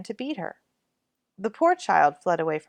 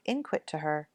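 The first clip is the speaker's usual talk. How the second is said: usual speaking voice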